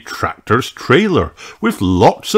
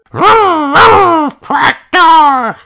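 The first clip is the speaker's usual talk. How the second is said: put-on very high, louder little-boy roar; toddler tractor noises; 6 dB louder, much slower than usual. A narrator's reading. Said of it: "said George".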